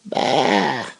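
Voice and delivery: short high bleat; a quick bleat as a rimshot; mock sheep bleat. Comedian bleats after the punchline.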